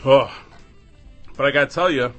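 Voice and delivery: normal talking voice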